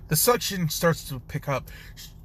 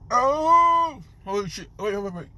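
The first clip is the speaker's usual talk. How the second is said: startled cry; 7 semitones higher, 3 dB louder than usual. Acted. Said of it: "he's just like".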